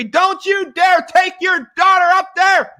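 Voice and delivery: high-pitched, drawn-out shriek of a mother; screaming mom. Retold or imitated. Wife screaming at husband about their daughter, retold scream. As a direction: imitate a mom screaming in alarm. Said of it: "Her mom was screaming at me".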